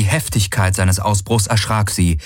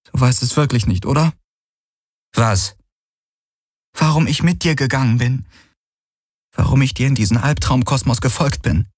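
put-on higher young woman's voice, hurt and reproachful; Kirai pleading; 6 semitones higher than usual. Kirai confronting Galen about his obsession, hurt and pleading. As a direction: plead with him, rushing the words.

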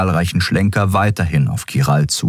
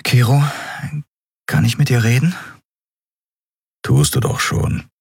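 put-on higher, urgent young woman's voice; Endriel, pressing; 4 semitones higher than usual. Captain pressing the silent Skria about their bond, urgent. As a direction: push him for an honest answer.

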